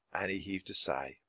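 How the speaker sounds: ordinary delivery, nothing marked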